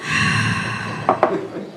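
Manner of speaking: long unvoiced heavy sigh; hammed-up sad sigh; mock depressed sigh. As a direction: sigh like the world is ending.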